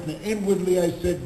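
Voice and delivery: ordinary delivery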